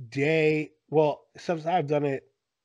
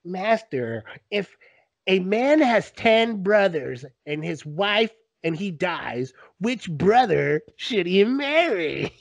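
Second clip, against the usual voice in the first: slow, put-on questioner voice; silly Bible voice; 3 semitones higher, slower than usual. Shown as a demonstration. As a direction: drag it out like a pompous questioner. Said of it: "how ridiculous they sound".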